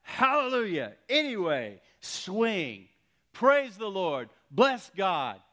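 high, loud preacher's shouts of praise; full-voiced, punched praise shouts; shouted praise. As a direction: shout each phrase out in triumph. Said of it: "He begins to shout".

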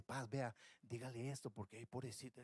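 a meddling brother murmuring advice, hushed; gossiping murmur. Pretend. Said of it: "cuchicheando ahí".

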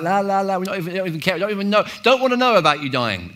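hammed-up la la la denial; mock fingers-in-ears. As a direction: disciples refusing to hear, sing-song. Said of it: "We're all like".

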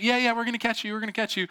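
high, quick glib reassurance; mock reassuring sisters. Sisters promising to catch their brother, mock reassuring.

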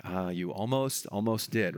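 low, soft, slow imitation of sadness; sad voice. Agrippa answering Paul as traditionally heard, sad voice. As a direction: say it low and quiet, as if regretful. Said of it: "in a, like, a sad voice".

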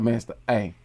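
soft, low put-on dad voice; lightly put-on talking-to, kept quiet. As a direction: drop low and soft to caution a kid. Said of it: "I put that voice on".

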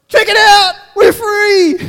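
high, loud whoop of celebration; excited Silas. Acted. Silas exulting to Paul after the jail doors open, excited shout. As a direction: yell it out, thrilled and triumphant. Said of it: "I just imagine Silas going to Paul".

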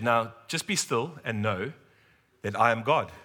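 slow, steady divine reassurance; measured and unhurried, voicing God; slow calm quote. God answers in the car.

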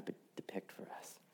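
a hushed whisper; whispered question. Real, not acted. Preacher asks the church what Lewis means, in a whisper. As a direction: drop to a whisper to draw them in.

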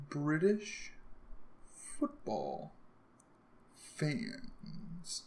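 drawn-out, halting words while typing a search; typing aloud. Real, not acted. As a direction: separate each word with a pause.

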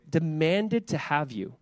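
demanded drawn out slowly with weight; stress on demanded. Shown as a demonstration. Pastor stressing Jesus' warning to Simon, slow and weighty. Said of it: "and he says it this way".

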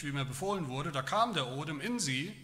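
usual speaking voice

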